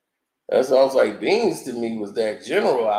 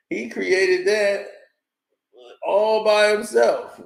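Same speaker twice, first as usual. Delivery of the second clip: sarcastic mockery; 7 semitones higher, slower than usual. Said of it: "mocking repeat".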